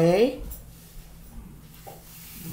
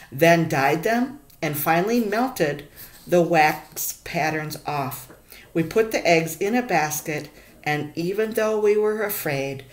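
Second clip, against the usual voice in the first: put-on reading a picture book aloud to kids; story narration. A narrator's reading. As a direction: tell the story to the class, expressive.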